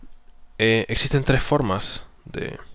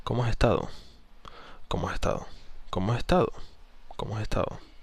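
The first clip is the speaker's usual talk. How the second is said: ESTADO contained and settling downward; assured falling tone; 6 dB quieter, faster than usual. Shown as a demonstration. Coach repeating the secure version, ESTADO neutral or falling. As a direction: drop ESTADO gently to sound sure of yourself. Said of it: "suena más seguro".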